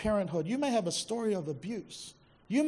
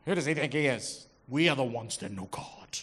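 mock Pharisees; 7 semitones lower than usual. Pharisees mocked, lower voice.

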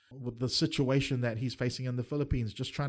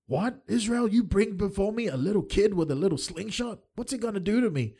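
higher, sneering giant's jeer; a lightly voiced giant taunting; mocking taunt; 6 semitones higher than usual. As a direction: scoff at the boy like a bully. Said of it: "taunting him".

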